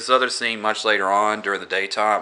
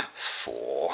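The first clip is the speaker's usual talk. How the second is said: low, soft contemptuous vampire voice; sneering vampire; 7 semitones lower, 8 dB quieter than usual. Retold or imitated. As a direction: drop the voice and sneer the word. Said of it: "and he says".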